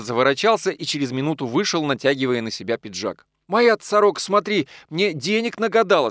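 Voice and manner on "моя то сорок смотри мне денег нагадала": playful, higher-pitched remark; joking, lifted brag; jocular. A narrator's reading. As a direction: tease about the wife lightly. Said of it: "сказал он шутливо".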